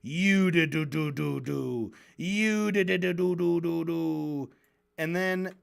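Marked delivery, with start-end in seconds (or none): 0.0-4.5 slow, stretched drone dissolving into nonsense syllables; mock droning interrupter. Host mocking debater who interrupts Norm, slow mocking drone. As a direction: drone over someone with mocking doo-doo filler. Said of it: "And he's saying".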